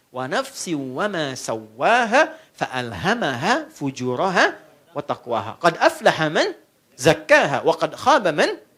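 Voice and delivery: slow, low chanted Arabic verse; Quran recitation. Acted. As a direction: recite the verse slowly like tilawah. (bacaan ayat Quran yang pelan)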